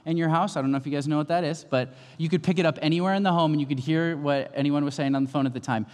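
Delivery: ordinary talk, nothing marked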